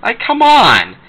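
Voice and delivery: high, loud fed-up plea; exasperated plea. Real, not acted. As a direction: throw up your hands in disbelief. Ranting about Baby Looney Tunes.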